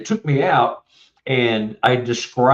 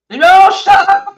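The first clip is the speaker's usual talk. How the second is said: high, loud reenacted scream; screaming and yelling; 9 dB louder than usual. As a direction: cry out to stop them. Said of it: "he's screaming and yelling".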